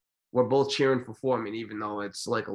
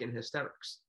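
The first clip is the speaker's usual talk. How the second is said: ordinary talk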